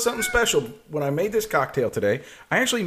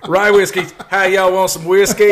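a hammed-up Southern host offering whiskey; Southern accent; 3 semitones higher, 8 dB louder than usual. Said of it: "The accent?".

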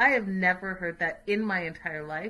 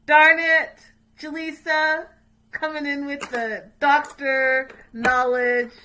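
mock exclamation; 6 semitones higher, 12 dB louder, slower than usual. Real, not acted. Playful disappointment at butter answer.

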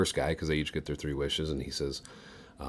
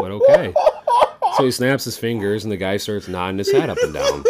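laughing; 5 semitones higher, 10 dB louder than usual. Real, not acted. Laughing through the punchline build.

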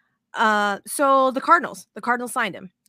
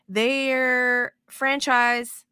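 drawn out; 2 semitones higher, 3 dB louder, much slower than usual. Real, not acted. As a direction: stretch "franchise" very slowly. Drawn-out hesitation.

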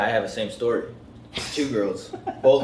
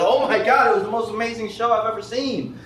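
high, gushing fan impression; pitched-up mock excitement; imitated thrilled fan; 9 semitones higher than usual. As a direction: gush as an overjoyed audience member. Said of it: "they're, like, thrilled".

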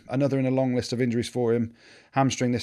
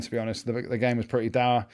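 ordinary talk

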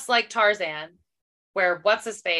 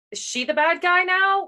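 puzzled; 10 semitones higher, 3 dB louder than usual. Retold or imitated. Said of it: "you're like".

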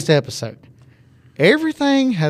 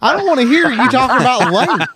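laughing tease; 6 semitones higher than usual. Real, not acted. Teasing friend while laughing.

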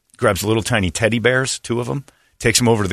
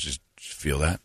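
cop's spooky low hush; 5 semitones lower, 11 dB quieter than usual. Retold or imitated. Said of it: "he turns to me and goes".

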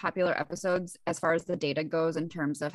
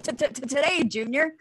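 mock stutter, pitched up a bit; stuttering dad impression; 6 semitones higher, 3 dB louder than usual. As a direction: tease her by stammering like her dad. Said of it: "I'm my dad".

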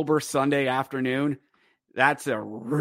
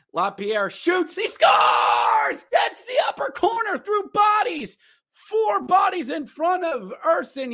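goal call; 13 semitones higher than usual. Acted. Vlogger recreating the goal broadcast, excited shout.

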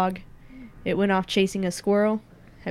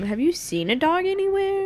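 high-pitched question; 11 semitones higher than usual. Acted. Pushy question to clerk.

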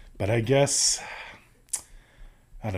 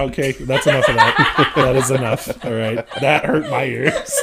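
ordinary talk, nothing marked

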